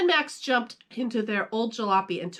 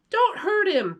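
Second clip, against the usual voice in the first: put-on high, louder pleading kid; begging; 12 semitones higher, 5 dB louder than usual. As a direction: beg him to stop. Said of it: "P J begged".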